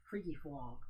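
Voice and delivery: usual speaking voice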